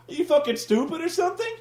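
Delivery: ordinary talk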